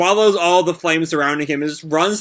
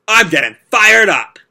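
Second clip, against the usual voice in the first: louder, higher battle cry; fired-up shout; 6 semitones higher, 8 dB louder than usual. Acted. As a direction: roar out the catchphrase. Natsu powering up.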